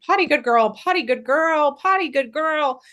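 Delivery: high, sing-song praise for a puppy; positive tone. Shown as a demonstration. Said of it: "we're saying this in a positive tone".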